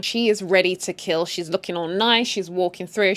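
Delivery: usual speaking voice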